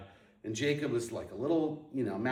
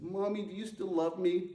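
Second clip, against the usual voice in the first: higher, imitating a small child's whine; whiny kid voice; 8 semitones higher than usual. As a child calling down to mom, a light mock whine. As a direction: whine it like a kid begging for sympathy. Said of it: "kinda putting on this whiny voice".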